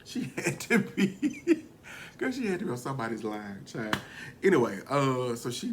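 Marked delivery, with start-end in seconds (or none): 0.0-1.6 laughing. Real, not acted.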